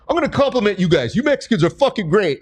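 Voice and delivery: high, quick mock fan service; gushing crowd-pleaser. Performer flattering every Mexican in the crowd, high and gushing.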